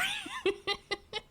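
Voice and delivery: very high squeal of laughter. Real, not acted. Laughing at own roar.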